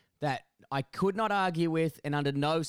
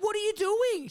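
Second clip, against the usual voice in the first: high, louder disbelieving cry; shocked; 5 dB louder than usual. Retold or imitated. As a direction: caught off guard in his own yard. Shocked at intruding neighbour.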